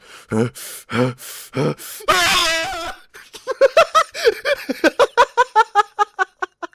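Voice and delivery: heavy mock huffs, then loud high cackling; imitated huffing breaths bursting into laughter. Storyteller imitating his classmate, huffs breaking into loud laughter. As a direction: huff in imitation, then crack up uncontrollably. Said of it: "He looked at me going".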